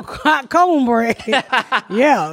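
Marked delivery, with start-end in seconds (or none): 0.0-1.2 slow, stretched hot cornbread; lazy savoring drawl. Shown as a demonstration. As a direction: linger on each word.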